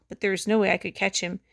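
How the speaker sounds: usual speaking voice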